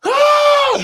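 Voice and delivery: very high loud shriek of fright; startled scream. Retold or imitated. Retelling June beetle attack to friends, high startled shriek. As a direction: shriek as a bug hits your chest. Beetle grabs him in hot tub.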